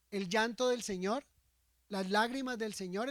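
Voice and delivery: ordinary talk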